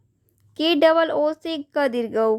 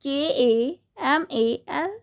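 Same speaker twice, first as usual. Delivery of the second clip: slow spelling; slower than usual. Shown as a demonstration. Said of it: "तो हम लोग कमल कैसे लिखेंगे?".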